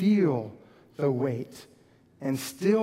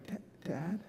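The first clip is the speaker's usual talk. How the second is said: very soft, broken tearful dad; older kid on the phone, sniffling and near tears; 13 dB quieter than usual. Shown as a demonstration. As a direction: choke it out quietly through sniffles. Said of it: "they're sniffling like".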